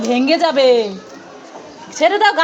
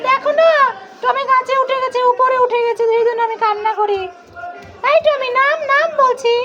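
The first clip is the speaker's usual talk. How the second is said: put-on high, complaining little-kid voice; upset child figure; 9 semitones higher than usual. Toy child complaining that Tommy climbed the tree, in a high voice. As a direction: whine it high like a small child telling on a pet.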